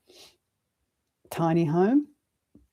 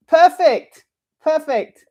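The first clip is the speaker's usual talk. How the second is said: much higher and louder, joyful; teacher praising assistant for the blind, loud high joy; loud praise; 11 semitones higher, 7 dB louder, slower than usual. Real, not acted. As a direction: praise her across the room.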